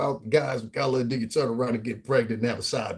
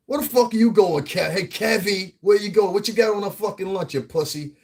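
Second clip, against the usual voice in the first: louder, higher-pitched mock shakedown; schoolyard bully taunt; 7 semitones higher, 3 dB louder than usual. Schoolmate confronting a nerd over school lunch, bullying taunt. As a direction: call him out like a bully after lunch money. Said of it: "That's what we would have addressed him as".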